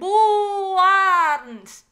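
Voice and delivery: loud, high, drawn-out mock cheer; celebratory whoop. Vlogger marking half a million subscribers, loud celebratory whoop. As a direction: blurt out a big booming noise.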